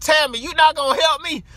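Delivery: high, loud plea to her cousin; pleading. Retold or imitated. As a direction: beg your cousin, pitch shooting up.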